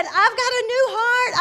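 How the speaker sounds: high, loud exultant quote; excited retelling. Retold or imitated. Retells mother's excited miracle news.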